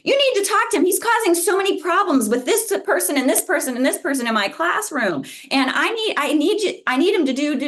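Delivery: a teacher yelling at the tutor about a problem student. Retold or imitated. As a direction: scold like a frazzled teacher at the end of her rope. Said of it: "like, yelling at me".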